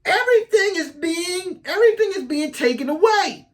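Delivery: high-pitched take on a podcaster's lament. Acted.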